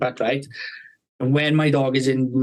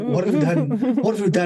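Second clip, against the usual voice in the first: higher-pitched mock horror, repeated; movie-style horrified missus; 6 semitones higher than usual. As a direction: imitate a shocked wife in a film. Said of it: "like a scene of a film".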